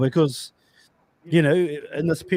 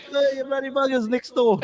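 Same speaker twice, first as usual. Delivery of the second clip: mock calling out; 9 semitones higher than usual. Host jokingly telling off noisy neighbours, mock yell. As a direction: pretend to holler over the fence.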